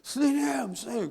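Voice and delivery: high, fast pupils' protest; imitated whining. Annoyed pupils protesting punishment to teacher, mock whine. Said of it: "애들이 막 짜증을 내요".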